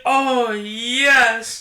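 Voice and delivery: high, breathless mock ecstasy, slowed on the love; excited date. Host imitating Frankenhooker's date swooning, high hammed-up ecstasy. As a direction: gush like a smitten sleazy client.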